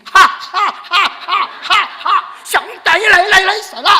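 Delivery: high, loud mock martial-arts shouts; hammed-up kung fu fighting yells; kung fu cries. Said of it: "중국 무술하듯이 다 막았어".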